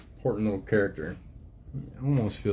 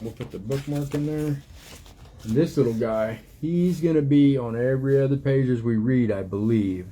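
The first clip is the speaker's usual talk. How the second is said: ordinary delivery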